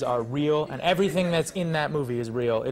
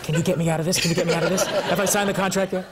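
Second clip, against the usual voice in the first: higher, louder mock-desperate plea; hammed-up begging to be let out; mock panic; 7 semitones higher, 5 dB louder than usual. Actor imitates colleague calling his agent, mock desperate.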